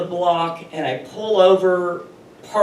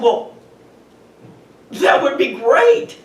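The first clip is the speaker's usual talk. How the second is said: higher and louder, laughing through it; laughing delight; 8 semitones higher, 6 dB louder than usual. Retold or imitated. Woman answers the policeman who found her thieves, laughing. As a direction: giggle with disbelieving joy.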